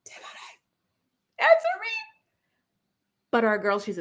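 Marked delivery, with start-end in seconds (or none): 0.0-0.6 barely a breath, unvoiced; whispered name. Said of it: "he's asking her if she's still there". 1.4-1.9 pleading. Retold or imitated. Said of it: "he's asking her if she's still there".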